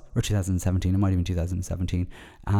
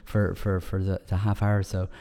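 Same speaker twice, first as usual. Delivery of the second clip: ordinary talk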